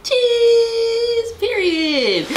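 high-pitched shouted cheese for the photo; camera cheese call. Real, not acted. Posing for her thumbnail, she shouts cheese up high. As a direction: squeal it out for the camera.

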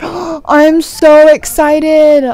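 gasp, then loud, stretched-out words; very excited. Real, not acted. Player seeing the little treasure chest, loudly excited. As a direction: gush it slowly at full volume.

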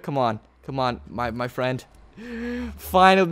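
usual speaking voice

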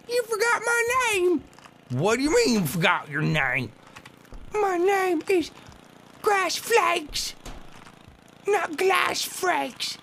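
high slow squeaky voice; squeaky grass flake. Pretend. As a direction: play a tiny offended grass flake, very high. Voicing grass flake character.

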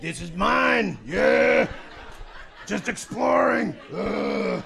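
loud, high triumphant shouts with a laugh; conquering explorer. Retold or imitated. A comic playing an explorer on a plane, shouting in triumph. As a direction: claim the aisle like a conqueror planting a flag. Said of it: "the Columbus of".

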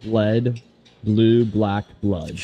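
very slow, each word laid out; slow down for the stumbling friend; slow clear demo. Shown as a demonstration.

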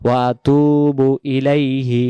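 ওয়া আতুবু ইলাইহি slowly, তু drawn out; slow model recitation. Shown as a demonstration. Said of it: "পেশের সাথে ওয়া অক্ষর সহ আদায় হচ্ছে". (ওয়া আতুবু ইলাইহি ধীরে, তু টেনে)

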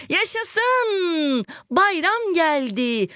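high cheer, then a slow, drawn-out bayram geldi; joyful cheer. Shown as a demonstration. Teacher showing joy for an exclamation mark, cheering. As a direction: cheer like a child on holiday morning. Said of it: "Sevinç var".

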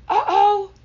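very high, loud uh-oh; alarmed exclamation. A narrator's reading. Giraffe tumbling down, high alarmed exclamation. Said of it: "said the giraffe".

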